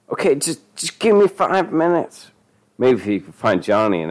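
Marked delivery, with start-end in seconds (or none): 0.1-2.3 high-pitched begging for time; panicked plea. A narrator's reading. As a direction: plead in a high, desperate voice. Denny begs dealer for time.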